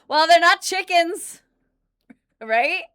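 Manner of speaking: loud, high insistence to chat about hens; emphatic. Real, not acted. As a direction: insist loudly. Arguing about Cornish hens.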